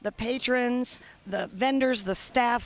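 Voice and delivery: normal talking voice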